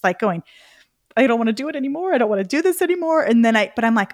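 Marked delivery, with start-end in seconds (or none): 1.1-3.2 fast, higher, despairing whine; mock-despair, on the verge of tears; near tears. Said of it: "I'm almost in tears".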